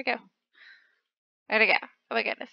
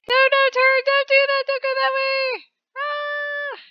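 high, loud desperate commands; panicked shout; 11 semitones higher, 6 dB louder than usual. Real, not acted. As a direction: yell at the character to turn.

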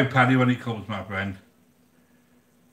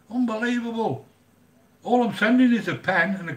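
exasperated; 9 semitones higher than usual. Real, not acted. Vlogger stunned by the postage price, high exasperated. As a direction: stretch it out in disbelief.

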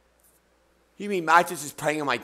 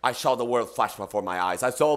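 mock ASMR; 8 semitones lower, 4 dB quieter than usual. Continues mock ASMR hosting voice.

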